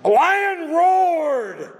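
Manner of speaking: very slow, high, loud proclamation; booming proclamation. Acted. Preacher crying out that God the lion has roared, booming. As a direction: cry it out like the roar itself.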